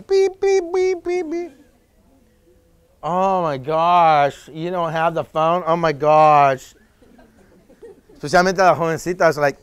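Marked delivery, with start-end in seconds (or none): 0.0-1.5 high mock beeping of old phone buttons; phone beep imitation. Speaker imitating teens' old flip-phone, high beeps. 3.0-6.7 put-on slow drawn-out valley girl oh my gosh; scornful teen girl. Teen girl mocking friend without iPhone, slow scornful drawl.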